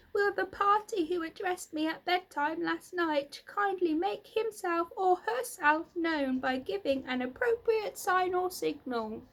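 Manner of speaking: high, loud formal announcement; loud, firm. A narrator's reading. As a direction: announce firmly to the whole barn. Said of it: "in a loud, firm voice".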